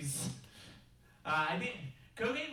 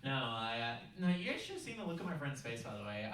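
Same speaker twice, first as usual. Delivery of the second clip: ordinary delivery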